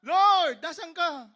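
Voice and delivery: loud, high desperate call; shouting. Acted. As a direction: yell out for someone lost from sight. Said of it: "Sumisigaw siya". (malakas na pasigaw na pagtawag)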